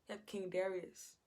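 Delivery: usual speaking voice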